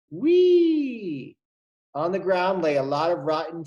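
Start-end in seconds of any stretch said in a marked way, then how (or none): 0.1-1.4 high, loud gleeful whee; playful whee. Acted. Teacher reading the monkey swinging, gleeful squeal.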